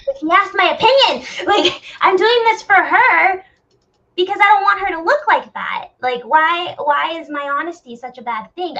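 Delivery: high, loud, pleading outburst; exasperated protest. Retold or imitated. To her friend, retelling her own exasperated plea. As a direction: throw up your hands, why is honesty bad. Frustrated retold self, defending bluntness.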